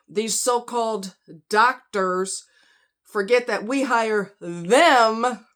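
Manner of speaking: very slow, pointed delivery; slow emphatic. Real, not acted. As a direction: spell it out slowly. Angry review reading.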